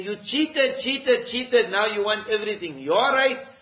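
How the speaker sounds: high, loud accusing cry, mock bride; indignant reproach. Bride rebuking a cheating groom, indignant and loud. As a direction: throw his cheating back at him.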